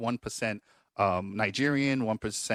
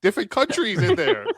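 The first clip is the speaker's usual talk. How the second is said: louder, laughing mock confession; mock family voice; 6 semitones higher, 5 dB louder than usual. Host imitating the family line, laughing hammed-up voice. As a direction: ham up your ancestors boasting. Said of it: "it was just like".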